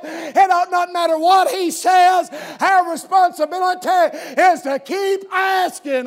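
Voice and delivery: loud preacher's cadence, slowing on the last lines; preaching at full force. Acted. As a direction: drive it home to the congregation.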